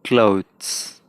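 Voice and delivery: क्लोथ्स said almost like close; clothes modelled on close. Shown as a demonstration. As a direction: let क्लोथ्स sound near close. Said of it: "जैसे क्लोज है ना, क्लोज, वैसे ही".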